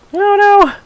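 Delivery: high startled cry; sudden high yelp at a game setback; surprised yelp. Real, not acted. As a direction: squeak out a sudden oh no. Startled gamer reaction.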